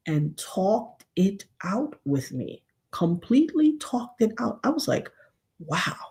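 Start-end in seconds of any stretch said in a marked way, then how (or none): 5.6-6.0 soft, low "wow"; understated, softly awed; hushed amazement. Retold or imitated. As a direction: imitate being quietly stunned. Recalls her amazement.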